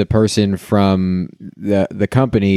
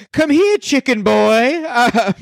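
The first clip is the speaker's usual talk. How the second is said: mock medieval patron; 11 semitones higher than usual. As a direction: summon the serving boy like a drunk lord. Drunk patron ordering, medieval manner.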